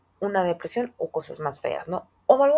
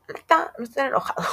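a hint of a child's voice, higher; calmed-down kid; 5 semitones higher, 4 dB louder than usual. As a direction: play the kid who's over it. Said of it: "se les baja y dicen". (niño ya calmado, voz aguda)

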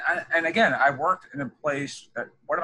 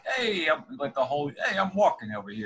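higher, lightly mocked New York accent; hammy New York street voice, pitched up; 8 semitones higher, faster than usual. Host playing a New Yorker to the guest, mock New York accent. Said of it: "got used to the New York".

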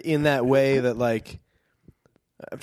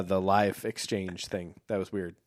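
normal talking voice

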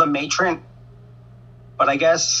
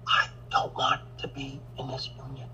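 hushed unvoiced whisper to the camera; 10 dB quieter than usual. Real, not acted. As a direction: lean in and whisper the point. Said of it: "Why are you whispering to the camera?".

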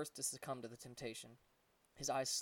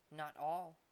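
a little monkey gently correcting him; small monkey voice; 3 semitones higher than usual. A narrator's reading. Monkey points at the boys.

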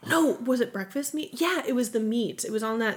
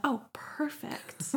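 whispered; 6 semitones higher, 8 dB quieter, much slower than usual. Retold or imitated. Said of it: "I'm like".

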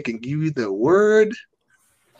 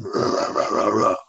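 low, whispery mock vigilante voice; Batman; 7 semitones lower than usual. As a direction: do a comic growly superhero imitation. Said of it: "This is Batman".

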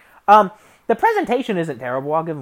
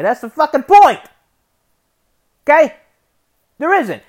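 frustrated outburst; 8 semitones higher, 8 dB louder than usual. Real, not acted. Ranting about the draft.

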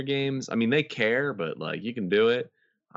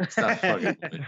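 high, laughing mock outrage; laughing mock complaint; 9 semitones higher than usual. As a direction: squeal it through a laugh. Imitating annoyed service, laughing.

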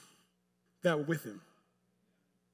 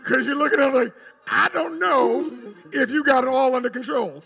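raised, high preacher's voice; preaching; 8 semitones higher, 10 dB louder than usual. Acted. Preacher urging the congregation to lean back and trust, preaching loudly.

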